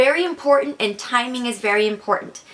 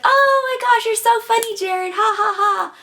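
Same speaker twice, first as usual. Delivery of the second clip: much higher, louder flirty gush with fake laugh; 11 semitones higher, 3 dB louder than usual. Shown as a demonstration. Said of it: "being friendly and just kinda like".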